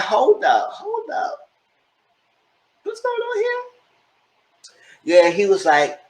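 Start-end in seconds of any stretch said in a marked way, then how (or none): 0.0-3.6 squealing high whisper of shock; a breathy shrieked double take; stunned disbelief. Retold or imitated. Everyone shocked at the reveal.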